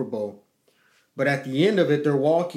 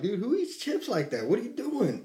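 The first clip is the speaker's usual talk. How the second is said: higher, softer exasperated scolding; mild mock telling-off; retold anger; 8 semitones higher, 5 dB quieter than usual. Dad retelling how he scolded his daughter, mildly exasperated. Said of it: "I get mad at her".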